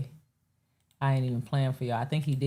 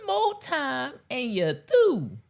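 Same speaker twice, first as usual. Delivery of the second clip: imitating the rant; 10 semitones higher, much slower than usual.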